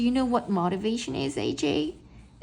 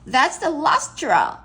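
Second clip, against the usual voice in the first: mock angry teacher; 6 semitones higher, 6 dB louder, slower than usual. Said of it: "she was all mad at me and she said".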